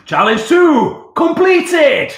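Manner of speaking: slow, loud, high announcement; slow announcer. Acted. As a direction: announce it like a ceremony. Announcing completed challenge, repeated.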